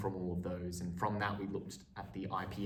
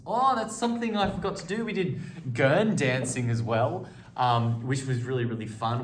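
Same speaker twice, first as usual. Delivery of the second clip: sudden burst of higher, louder delight; excited; 5 semitones higher, 10 dB louder than usual. Real, not acted. Student recalling gurn dancing for the class, excited and loud. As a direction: blurt it out as the memory hits.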